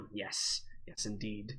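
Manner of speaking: low, soft, satisfied murmur; a quiet, contented old dwarf. Acted. GM's dwarf tasting the hair, softly pleased. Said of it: "And he's like".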